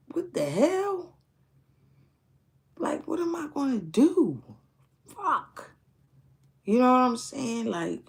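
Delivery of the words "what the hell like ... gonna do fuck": anguished outburst. Retold or imitated. Telling of rejection, crying out in high-pitched despair. Despair over not getting hired.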